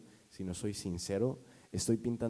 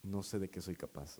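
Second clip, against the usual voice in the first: restrained muttered anger; 3 semitones lower, 7 dB quieter than usual. Retold or imitated. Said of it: "yo estaba muy enojado".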